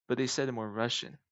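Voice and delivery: ordinary delivery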